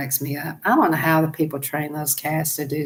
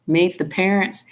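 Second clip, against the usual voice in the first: normal talking voice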